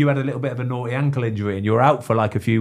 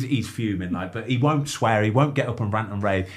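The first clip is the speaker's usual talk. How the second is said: ordinary talk, nothing marked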